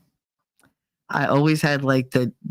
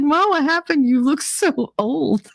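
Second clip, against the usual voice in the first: high, mocking kid voice, slowing at the end; a kid jeering at her mother's photo; teasing child; 10 semitones higher than usual. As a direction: tease mom like a bratty child. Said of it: "I'd be like".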